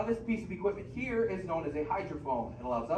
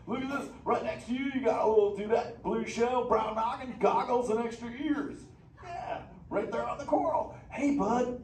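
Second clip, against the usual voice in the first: higher, louder surfer-dude turtle voice; a hyped, easygoing beach dude of a turtle; Crush the sea turtle; 6 semitones higher, 4 dB louder, slower than usual. Acted. Sea turtle greeting the kids in the theater, stoked surfer-dude voice.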